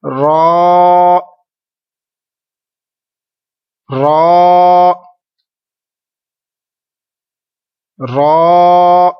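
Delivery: रॉ held long, loud and heavy; teacher leading the class in रॉ; drawn-out recitation. Shown as a demonstration. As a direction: stretch रॉ thick and full. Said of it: "अब मेरे साथ repeat कीजिए".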